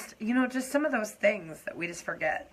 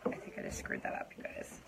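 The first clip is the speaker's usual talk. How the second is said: hushed, embarrassed whisper; a sheepish whisper; whispered aside; 12 dB quieter than usual. Real, not acted. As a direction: confess it under your breath. Makeup mistake aside.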